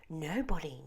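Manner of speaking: usual speaking voice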